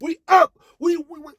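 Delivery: very high shouted bragging; excited phone shout. Retold or imitated. Narrator calling home about the win, very high shout. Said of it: "We are telling everybody".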